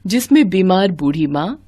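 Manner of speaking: ordinary delivery, nothing marked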